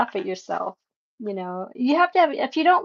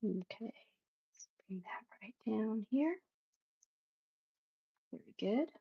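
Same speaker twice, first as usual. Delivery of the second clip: softly whispered while concentrating; focused whisper; 14 dB quieter than usual. Real, not acted. Painter murmuring to herself over her canvas, whispered. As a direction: breathe the words, eyes on the brush.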